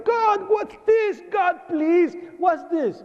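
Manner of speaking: high, very slow shouted anguish to God; crying shout. Retold or imitated. Survivor shouting to God on seeing the dead, anguished cry. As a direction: shout a desperate question at the sky. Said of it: "I started crying".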